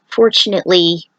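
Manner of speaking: ordinary delivery, nothing marked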